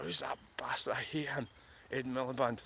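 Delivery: hushed muttered insult; under your breath, annoyed; whispered grumble. Retold or imitated. Inner thought, whispered.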